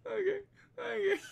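high, squeaky words through laughter; helpless laughter. Real, not acted. Comedian breaking up at own impression, helpless laughing. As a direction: try to recover from cracking up.

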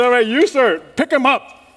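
loud, high command to the ushers, building; preacher ordering the ushers to lift him, loud; preacher's command. Retold or imitated. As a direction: bark it out like a preacher calling helpers. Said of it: "He said".

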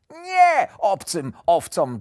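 loud, high, slow exasperated correction; getting annoyed. A narrator's reading. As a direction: snap back in frustration, word by word. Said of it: "denerwował się wilk".

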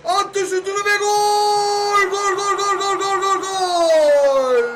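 long drawn-out goal scream; goal call. Acted. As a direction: stretch out the goal call, building. Calling a goal in the match.

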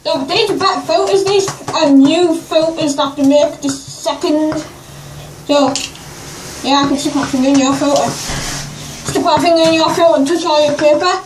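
muffled behind rubber respirator; speaking inside full face mask; through a gas mask. Real, not acted. Seen 3: Green full-face gas mask worn by the close speaker throughout.

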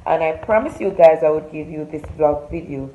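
loud, punchy host greeting to the camera; upbeat vlog intro. Acted. Vlogger welcoming her followers before the story, loud upbeat host voice. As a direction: hype up your followers like a show host.

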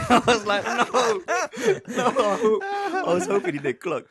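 high, loud "no" through laughter; laughing mock dismay. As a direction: crack up while reliving your dismay. Laughing retelling of his panicked reaction.

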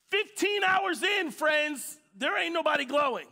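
a preacher shouting to his congregation. Acted. Peak of sermon story.

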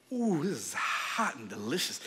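low, soft relish; hushed mock relish over hot soup; savoring tease. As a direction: savor it to tempt a hungry brother. Acting Jacob savoring the soup.

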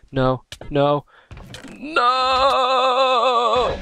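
building from loud protest to a high yelled no; despairing shout. Real, not acted. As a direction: cry out as you die. Dying at the border.

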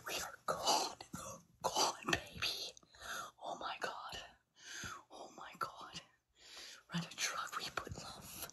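a breathless, teary whisper, barely voiced; overcome, just breathing the words out; tearful whisper. Real, not acted. Streamer overwhelmed by her viewers' gifts, in a tearful whisper.

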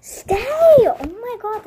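loud, exasperated cry at the tripod; exasperated outburst. Real, not acted. Vlogger yelling at her toppling tripod, exasperated. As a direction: yell at the falling tripod. Tripod keeps falling over.

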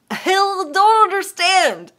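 mock outrage at hot sweet drinks; exasperated outburst. Real, not acted. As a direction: throw up your hands at the idea. Ranting about hot sweet drinks.

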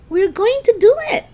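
high-pitched, triumphant excitement; beaming. Acted. Lisa sees the crowd grow, beaming. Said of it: "Lisa beamed".

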